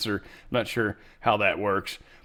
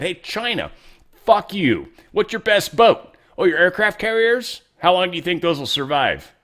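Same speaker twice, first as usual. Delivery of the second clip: mock taunt; 7 semitones higher, 6 dB louder than usual. Mock taunt addressed to China.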